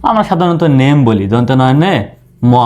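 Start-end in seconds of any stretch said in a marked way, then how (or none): none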